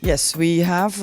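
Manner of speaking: ordinary talk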